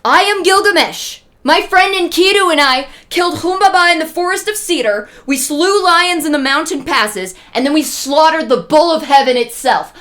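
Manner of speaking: a hero bellowing his own legend; boastful yell. Retold or imitated. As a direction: shout your deeds to scare her. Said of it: "and yells".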